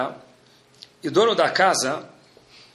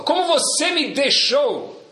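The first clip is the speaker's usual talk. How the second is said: mock indignation; 10 semitones higher, slower than usual. Acting out hurt indignant reaction.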